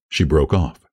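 usual speaking voice

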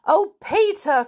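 high, loud wail to Peter; dramatic, dismayed exclamation; despairing cry. A narrator's reading. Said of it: "cried Molly in despair".

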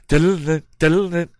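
high, loud sung clip-clop jingle; cartoon gallop sound. The reader joking about cartoon chase music, mock jingle. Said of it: "music sound effects from the old cartoons".

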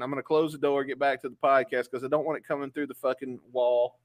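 ordinary talk

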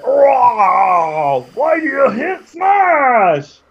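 high, slow and far-off troll; dumb troll voice. Acted. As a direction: drag the words out like a confused troll.

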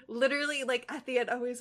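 normal talking voice

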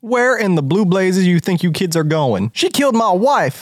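high, indignant accusation; outraged country villager. Acted. Villager accuses stranger of killing wife, high and outraged. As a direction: protest hotly, voice jumping up.

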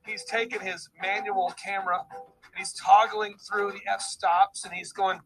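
usual speaking voice

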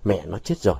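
low, hushed and stricken; faltering voice. A narrator's reading. Vũ finds Vọng's mother dead, faltering voice. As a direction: let the voice drop away softly. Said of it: "giọng nó lạc đi".

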